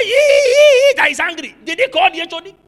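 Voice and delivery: loud, high, outraged preaching; furious retold rant. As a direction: relive the rage at the workers' meeting. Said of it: "I was most furious".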